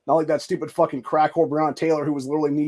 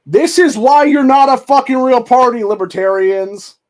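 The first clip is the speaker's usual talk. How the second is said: angry shout; 7 semitones higher, 7 dB louder than usual. Real, not acted. Ranting at libertarian party.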